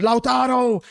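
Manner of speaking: high, loud call of the name; excited shout. Real, not acted. Chance during live match.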